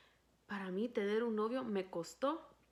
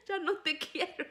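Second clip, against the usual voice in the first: laughing; 10 semitones higher than usual. Retold or imitated.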